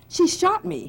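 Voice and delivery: high, loud indignant cry; outraged, you can't believe she shot you; indignant outburst. Acted. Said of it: "His voice rang with indignation".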